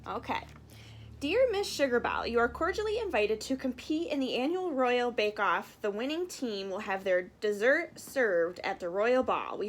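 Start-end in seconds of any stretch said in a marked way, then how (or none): none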